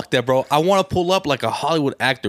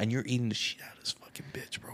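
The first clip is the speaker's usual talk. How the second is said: unvoiced, much softer whisper; whispered aside; 2 semitones lower, 12 dB quieter than usual. Real, not acted. Telling his friend the crude detail, whispered. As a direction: drop to a secret whisper.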